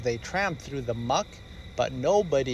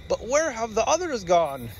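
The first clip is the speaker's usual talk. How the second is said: worried cry; 8 semitones higher than usual. Acted. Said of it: "Daddy Bear cried".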